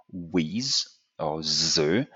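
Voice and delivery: WITH as "wiz" and THE as "zuh"; z in place of every th in WITH and THE; French-style th turned to z in WITH, THE; French mispronunciation. Shown as a demonstration. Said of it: "they kind of pronounce it as".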